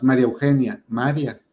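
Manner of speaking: "María" squashed to MÁ-ria before Eugenia; run together. Shown as a demonstration. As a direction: stress the first A of "María", no break in "ia". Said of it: "como lo decimos de corrido".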